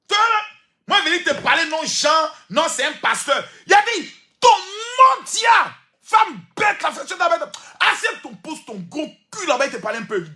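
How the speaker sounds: hammed-up furious pastor scolding; ranting pastor impression. Pastor scolding a foolish woman, mock high shouting. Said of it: "Un pasteur ne parle pas comme ça".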